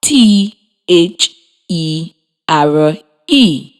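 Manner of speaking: slow letter by letter, T H E R E; teacher spelling a sight word for the class; spelled out slowly. Shown as a demonstration. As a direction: spell each letter apart for young learners. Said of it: "it is spelled".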